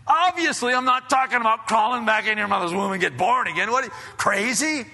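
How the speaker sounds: high, loud, incredulous scolding; mock exasperation. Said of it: "he didn't say it like that".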